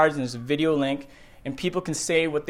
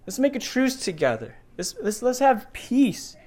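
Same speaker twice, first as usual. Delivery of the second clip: high, amazed outsiders pleading for a truce; awed non-believers; 6 semitones higher, 4 dB louder than usual. Retold or imitated. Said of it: "these non-believers are saying".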